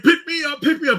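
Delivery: high, loud pleading demand; drunk fan begging. Retold or imitated. Drunk fan begging to be picked up, high and loud. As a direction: demand it like a drunk big guy. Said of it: "the guy's like".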